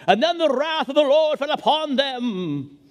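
booming, lofty King James reading; grand Shakespearean. Pretend. Preacher mocking archaic scripture, grand booming declaim. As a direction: declaim it like an old Bible epic. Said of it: "something Shakespeare would use".

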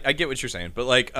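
ordinary talk

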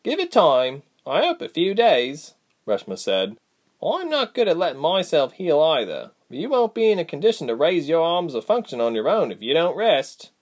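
a sharper voice, irritated but caring; stern Reshma. A narrator's reading. Reshma's dialogue.

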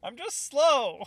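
high, drawn-out comic voice; jokey put-on voice. Joking with friends about being slow, jokey put-on voice. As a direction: stretch it out slow, high and loud.

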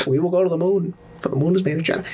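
put-on upper-echelon Boston accent; JFK. Repeating the moon speech for a co-host, Boston accent oratory. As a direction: orate in a mock old-money Boston accent. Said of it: "that's the upper, upper echelon".

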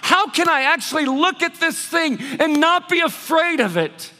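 loud, high, impassioned preaching; full-force preaching. Acted. Pastor preaching hard to the congregation, loud and high. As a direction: drive every line home from the pulpit.

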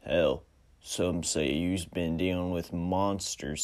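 wary gunslinger, sizing him up; western stranger drawl. Acted. Stranger accuses.

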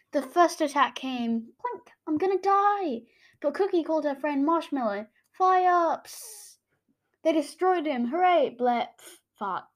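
hammed-up comic reading with voices; wild unhinged ranting. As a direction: act out the comic wildly. Reads her childhood comic aloud.

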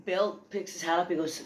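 ordinary delivery

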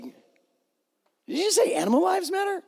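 high, slow, disbelieving; mock disbelief, drawn out high; incredulous. Pastor mocking a critic's comment, incredulous high voice. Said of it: "You're just like".

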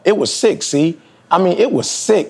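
high, outraged "it was sick"; appalled, pitched high; indignant disbelief. Real, not acted. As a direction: let the disgust rise up high. Indignant about defending abuser.

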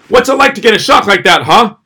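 loud, high, taunting yell; mock angry yell at driver. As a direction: taunt her like she scared you. Confronting the driver at stoplight.

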